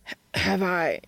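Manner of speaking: stammered, startled question; sputtering. A narrator's reading. Dean chokes on his beer at Cass's question, sputtering. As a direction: stumble into the words, thrown. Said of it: "he sputters".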